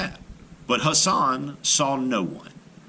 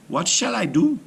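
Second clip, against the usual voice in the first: raised, higher, fretful plea; despairing cry; 9 semitones higher, 4 dB louder than usual. A narrator's reading. Hassan begging his daughter for help, despairing cry. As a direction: throw up your hands in despair. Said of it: "he cried".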